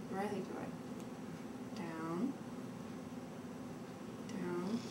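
whispered coaxing; whispering. Real, not acted. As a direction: coax her in a whisper.

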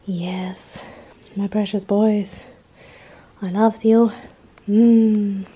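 slow, doting pet talk; cooing at pets. Real, not acted. Owner cooing lovingly at her cats. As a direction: coo over your cats.

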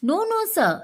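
high-pitched storybook protest. A narrator's reading. Mohan answers the senior master, protesting in a high voice. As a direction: pitch the refusal right up.

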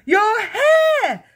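very high, loud mother's shriek. A narrator's reading. Mother points at her husband, shrieking. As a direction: shriek it out at full pitch. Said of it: "the mother was shrieking".

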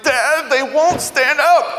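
put-on high, drawn-out kid's wail of frustration; upset child's loud high protest; frustrated whining child. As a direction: whine it like an exasperated little boy. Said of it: "he'll just get angry".

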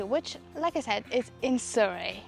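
ordinary talk, nothing marked

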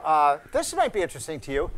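ordinary talk

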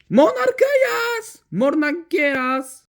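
very high, drawn-out proclamation; grand name announcement. Pretend. Villain asked who he is, grand high proclamation. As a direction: proclaim your name slowly, high and theatrical.

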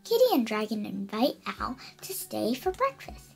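plain reading voice

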